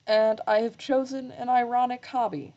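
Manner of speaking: ordinary talk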